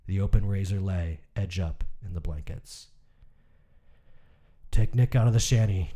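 measured, somber reading of a short story; story narration. A narrator's reading. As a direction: read it like an audiobook narrator, even and grave. Reads a Hemingway story aloud.

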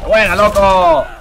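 loud, drawn-out cheer; excited shout. Real, not acted. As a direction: yell approval at a great move. Cheering a move in the game.

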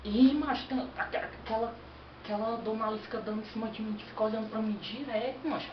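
lightly voiced irritated rich kid; angry rich kid. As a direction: complain like a spoiled rich boy. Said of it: "imitar o Mauricinho, que tá com raiva".

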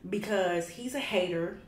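loud, heated rant at a hater; loud angry ranting at a hater. Real, not acted. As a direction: let the fury build as you call him out.

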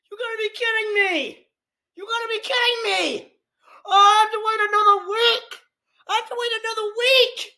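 high-pitched wail of disbelief; exasperated whine. Real, not acted. Fan who has to wait another week, exasperated whine. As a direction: complain in a high, pleading voice.

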